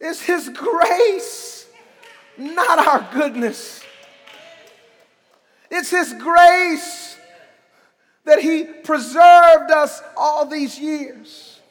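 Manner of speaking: slow, drawn-out stress on grace; preacher dwelling on grace; slow preaching. Acted. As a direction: lean hard into each grace.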